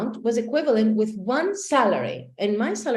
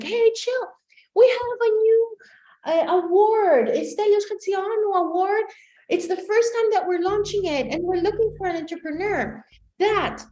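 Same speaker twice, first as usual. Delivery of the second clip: high-pitched, singsong announcement; acted perky professor; enthusiastic professor; 10 semitones higher than usual. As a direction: announce it eagerly in a much higher voice. Imitating the professor announcing an award.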